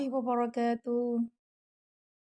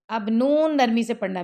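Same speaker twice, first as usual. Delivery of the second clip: usual speaking voice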